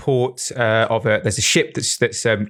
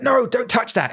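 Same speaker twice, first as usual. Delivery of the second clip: mock shout; 12 semitones higher than usual. Retelling a shout heard before the bang, mock shout. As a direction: mimic someone shouting in alarm. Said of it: "someone shout and be like".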